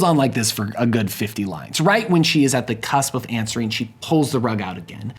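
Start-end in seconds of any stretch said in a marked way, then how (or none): none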